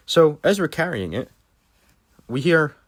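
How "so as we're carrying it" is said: usual speaking voice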